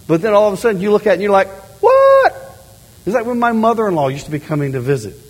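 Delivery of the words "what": very high, loud squeal of dismay; hammed-up shriek of surprise; shocked what. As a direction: yelp it in horror. Shock at messy house.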